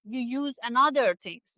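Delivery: ordinary talk, nothing marked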